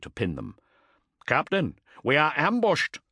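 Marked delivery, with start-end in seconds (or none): 1.3-3.0 high, loud urgent yell; shouted over gunfire. A narrator's reading. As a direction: yell to be heard over the din. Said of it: "he yelled into the vox in an attempt to overcome the din".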